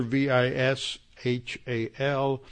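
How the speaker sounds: letter by letter, slowly; spelling it out. Shown as a demonstration. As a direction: spell each letter clearly. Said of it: "His first name is spelled".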